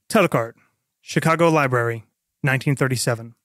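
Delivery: ordinary talk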